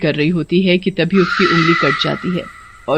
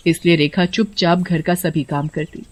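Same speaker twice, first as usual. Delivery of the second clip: ordinary delivery, nothing marked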